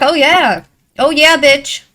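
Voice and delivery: loud macho cop swagger; Elliot Stabler tough cop. Retold or imitated. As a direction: bark it like a hotheaded TV detective. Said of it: "the inspiration for Elliot Stabler".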